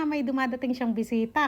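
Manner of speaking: ordinary talk, nothing marked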